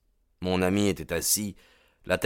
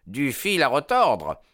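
much higher, loud and pleased; gleeful exclaim; 6 semitones higher, 4 dB louder than usual. A narrator's reading. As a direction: crow it out, delighted. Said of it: "s'écria-t-il".

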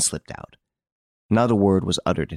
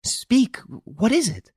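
much higher, urgent question; a wife pressing for news, pitched up high; high eager demand; slower than usual. Acted. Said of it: "His wife questioned him eagerly".

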